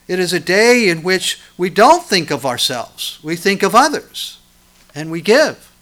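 full-force preaching. Acted. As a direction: drive each phrase home from the pulpit.

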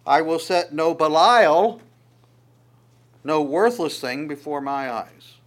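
slow, emphatic recitation; slow psalm recitation. Acted. Preacher reciting Psalm 101 to the congregation, slow and emphatic. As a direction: lean on each word of the verse.

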